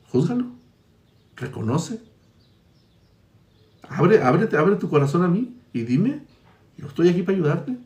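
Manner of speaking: a hint of God gently calling the sinner to open his heart; God inviting. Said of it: "Dios dice".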